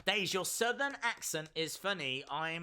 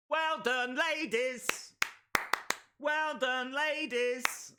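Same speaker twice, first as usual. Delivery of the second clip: cheering praise; 6 semitones higher, slower than usual. Real, not acted. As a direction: call out well done, ladies with pitch up. Applauding wrestlers on stream.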